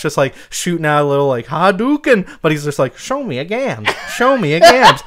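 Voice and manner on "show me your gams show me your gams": high, loud, hammed-up transatlantic dandy; twirly-mustache dandy fighter. Host voices a mustached dandy fighter's attack in transatlantic accent. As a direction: play a mustached dandy firing his catchphrase. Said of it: "a guy with a twirly mustache".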